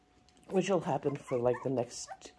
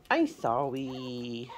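ordinary talk

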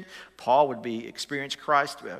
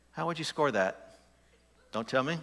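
usual speaking voice

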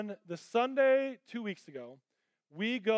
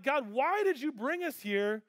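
pleading with God; 6 semitones higher, 3 dB louder, slower than usual. Retold or imitated. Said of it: "And I'm like".

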